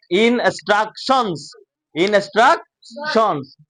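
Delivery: instructions slowly as in-struk-shons; teacher dividing up a long instructions; broken into parts. Shown as a demonstration. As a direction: separate each syllable of instructions. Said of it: "সেটাকে ভাগ করে করে পড়বো".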